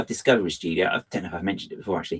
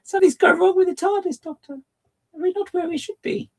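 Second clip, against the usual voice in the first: Doctor Who companion; 4 dB louder than usual. Acted. Companion asking the Doctor about the tardis, worried and high. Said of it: "stories used to start by".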